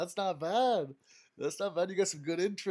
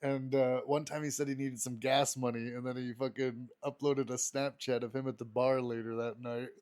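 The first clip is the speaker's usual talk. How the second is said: ordinary delivery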